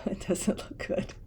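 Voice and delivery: hushed laugh through words; laughing softly. Real, not acted. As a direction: chuckle under your breath.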